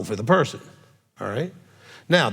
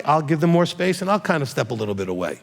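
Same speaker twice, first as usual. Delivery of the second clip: usual speaking voice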